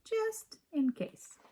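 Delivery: high, slow and knowing; drawn out with a little girl's certainty; Lily's line. A narrator's reading. Lily tells the boys in a high slow voice. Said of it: "said Lily".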